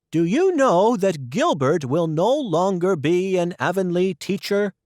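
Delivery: a busy neighbour bringing news; Rachel Lynde's voice. Acted.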